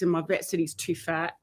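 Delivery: ordinary talk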